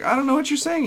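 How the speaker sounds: put-on high-pitched confused girl; high girly voice. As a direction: play a confused young woman. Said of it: "the girl's like".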